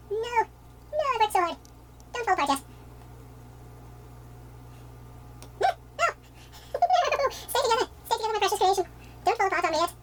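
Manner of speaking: high pleading cries; dramatic despair. Real, not acted. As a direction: wail at the collapsing house. House falling apart.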